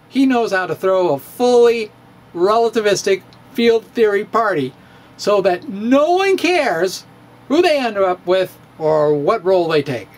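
usual speaking voice

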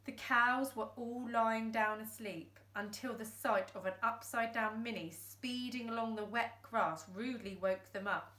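ordinary delivery

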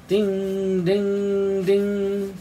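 high, sustained dings; imitated fire engine bell; three ringing dings on one high note. A narrator's reading. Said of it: "Fire engine bells rang".

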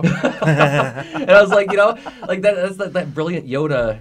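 loud, high, amused delivery breaking out of a laugh; laughing delight. Real, not acted. Laughing over a child's advice.

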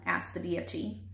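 usual speaking voice

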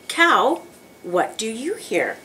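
ordinary talk

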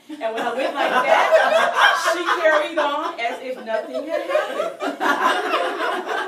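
can't hold the laugh in. Real, not acted. Narrator telling the audience the ending, laughing through the words.

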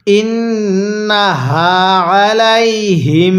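ইন্নাহা slowly drawn out with nasal ghunnah on নুন; ইন্নাহা recited slowly with ghunnah on the নুন; correct tajweed. Shown as a demonstration. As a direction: hold the doubled নুন of ইন্নাহা, then a clean হা. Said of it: "তাহলে".

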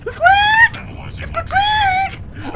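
very high, short rodent squeal; hamster squeak. Pretend.

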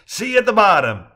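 high, loud send-off; called-out farewell. A narrator's reading. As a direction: toss a loud goodbye over to them. Calling farewell before bounding upstairs.

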